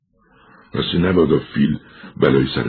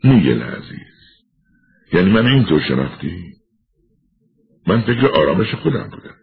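sad smile; 4 semitones higher than usual. Acted. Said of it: "لبخندی غمگنانه زد و گفت".

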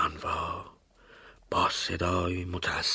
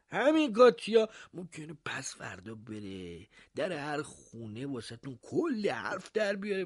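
high, half-hushed mayor advising; confidential mayor; 12 semitones higher than usual. Acted. Mayor taking the baker aside, hushed and confidential. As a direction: lean in and advise him in confidence. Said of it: "در گوشش زمزمه کرد".

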